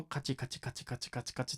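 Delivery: soft, tiny clicking sounds; small sound. Shown as a demonstration. Instrument teacher imitating small picked notes, softly. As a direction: murmur a run of tiny, even clicks. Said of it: "小さい音だけど".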